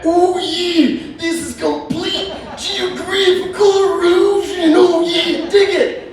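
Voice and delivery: high, strained wrestler voice, drawn out; hammed-up wrestler catchphrase delivery, slow and high-pitched; Macho Man Randy Savage. Wrestler marvelling at the Grand Canyon, hammed-up wrestler voice. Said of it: "What if Macho Man Randy Savage saw the Grand Canyon?".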